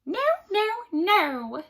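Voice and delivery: high, loud triple no; little man gleefully turns down each guess; little man refusing. A narrator's reading. Little man denies queen's guesses, high and loud. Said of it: "he replied".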